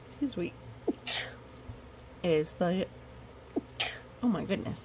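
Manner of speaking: usual speaking voice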